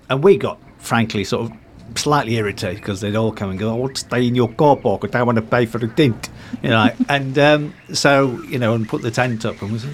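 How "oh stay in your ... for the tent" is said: imitated South African tourists; South African accent. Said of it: "forgive me my South African accent".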